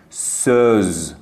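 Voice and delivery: سوز drawn out with a low throaty ö; slow deep ö. Shown as a demonstration. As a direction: stretch سوز slowly, ö from the throat. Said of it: "از توی گلوتون این صدا یه خورده بم". (سوز کشیده با صدای بم)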